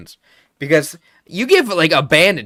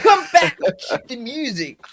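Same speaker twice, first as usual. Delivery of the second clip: shout; 9 semitones higher than usual. Pretend. Shouted, far voice.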